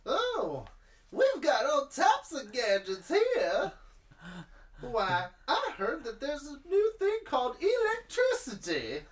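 put-on high, loud, drawn-out old-time patter; Carousel of Progress father. Imagining the ride's father in another scene, high hammed-up voice. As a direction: proudly show off newfangled gadgets in a put-on voice. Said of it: "he's got a good voice".

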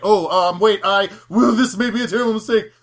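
timid man cornered by a confident woman; flustered panic. Retold or imitated. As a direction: backpedal in a fluster. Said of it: "he's like".

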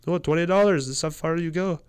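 a hint of a shrugging cabbie; mock cab driver. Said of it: "And he's like".

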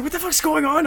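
high, startled mock disbelief; imitating his own stunned reaction; shocked. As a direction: squeak it out in retold alarm. Retold shock at scary guard.

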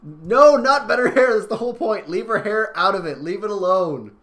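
loud, high, insistent protest; emphatic objection. Real, not acted. Co-host objects to fixing Zarya's hair, loudly indignant. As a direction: overrule them at full volume.

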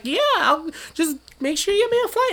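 high, breezy imitation of his friend; friend's easygoing reply. Friend casually asking only for a flight, high breezy mimicry. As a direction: shrug it off in her voice. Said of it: "And she's like".

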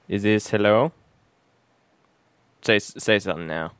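ordinary talk, nothing marked

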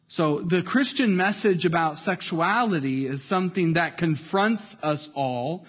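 usual speaking voice

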